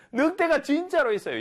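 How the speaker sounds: high, insistent calling voice; acted shouting. Shepherd boy insisting to villagers, mock shouting. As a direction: plead it at a high, carrying pitch. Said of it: "이렇게 막 소리를 질렀겠죠".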